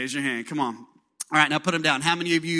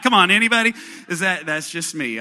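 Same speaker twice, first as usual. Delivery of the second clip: ordinary talk, nothing marked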